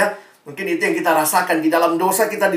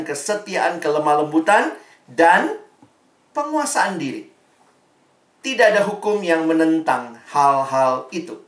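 ordinary talk

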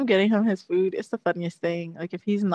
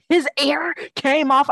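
higher, slow and louder echo of shock; mock disbelief; 6 semitones higher, 5 dB louder, slower than usual. Retelling a clinic worker's reaction to the ear, mock disbelief. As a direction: draw it out, incredulous. Quoting the incredulous vet's receptionist.

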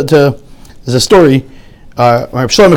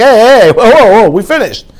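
louder, higher "hey, whoa" of mock alarm; mock protest; 4 semitones higher, 3 dB louder than usual. Classmate protesting the new lesson, hammed-up alarm. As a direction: wave it off like a kid cheated. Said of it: "I'm like".

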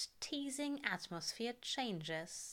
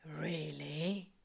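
drawling; 4 semitones lower than usual. A narrator's reading. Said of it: "Crowley drawls".

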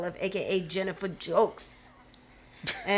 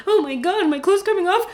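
mock panic; 10 semitones higher, 7 dB louder than usual.

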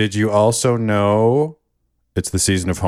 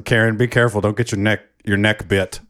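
ordinary talk, nothing marked

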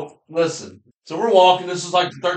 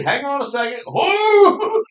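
high, loud cry of triumph; imitated whoop; 11 semitones higher, 4 dB louder, slower than usual. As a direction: holler like the gun just went off. Retelling hunter's whoop.

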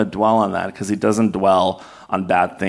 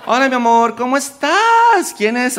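much higher, sing-song mother's greeting in Spanish; doting Spanish-speaking mom; 13 semitones higher, 4 dB louder than usual. Retold or imitated. Mom greeting her son sweetly on Union Square, sing-song and high. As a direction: gush at your son like a proud mom. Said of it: "She talks to me in Spanish".

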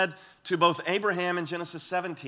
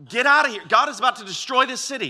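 higher, louder, hurried cry to flee; urgent warning; 7 semitones higher, 6 dB louder, faster than usual. Retold or imitated. Lot warning his sons-in-law to flee Sodom, urgent and loud. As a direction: sound the alarm to get them out now.

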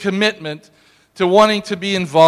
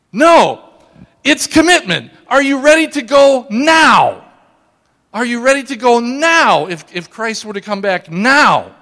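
loud, high, forceful challenge; impassioned pulpit shout; urgent preaching; 3 semitones higher, 6 dB louder than usual. Real, not acted. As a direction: press the congregation hard with a raised voice.

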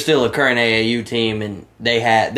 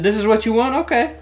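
high, defiant "this is what you want"; defiant retort; 10 semitones higher than usual. Retold or imitated. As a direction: answer back with a cocky challenge. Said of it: "Said, said".

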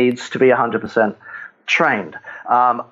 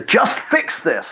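higher-pitched impatient father; overbearing dad; 9 semitones higher than usual. Acted. Results-oriented dad to his child, overbearing and higher-pitched. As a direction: act out a dad demanding results. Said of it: "overbearingly results oriented as dads".

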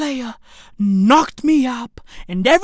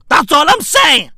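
usual speaking voice

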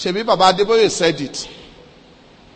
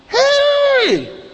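very high, long-held "hey"; startled realisation. Retold or imitated. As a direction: act out his stunned reaction, pitched way up. Pastor's startled realisation, retold.

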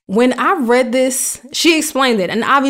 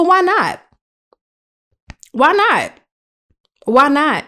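rhetorical challenge; 4 semitones higher, slower than usual. Acted.

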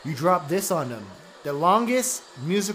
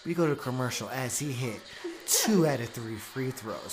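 announcer voice; 4 semitones lower, 4 dB quieter than usual. Acted. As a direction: call the game like a radio host. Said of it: "How you like that announcer voice?".

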